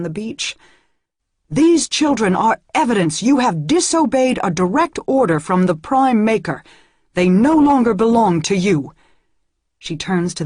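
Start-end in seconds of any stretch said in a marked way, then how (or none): 1.5-8.9 higher and louder, hard angry decree; harsh proclamation. A narrator's reading. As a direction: hurl the verdict at the crowd. Seeker condemns the villagers.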